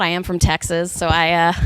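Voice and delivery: ordinary talk, nothing marked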